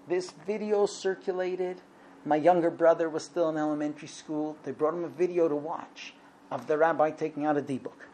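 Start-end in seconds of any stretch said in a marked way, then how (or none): none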